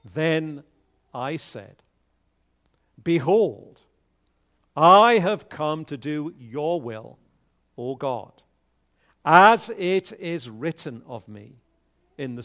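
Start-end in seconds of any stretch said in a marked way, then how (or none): none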